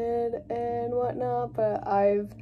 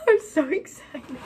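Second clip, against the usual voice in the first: higher and louder through a laugh; laughing excitement; 6 semitones higher, 6 dB louder than usual. Real, not acted. Vlogger gushing to viewers, laughing with excitement. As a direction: giggle the words out.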